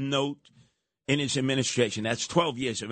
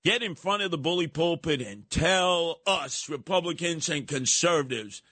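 normal talking voice

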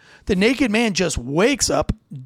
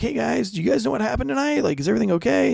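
put-on gentle with kids; 5 semitones higher, faster than usual. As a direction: coax the kids kindly. Cop talking gently to kids.

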